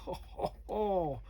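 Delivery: groaning under the weight; straining to hold it up; strained lifting. Real, not acted. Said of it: "it weighs a ton".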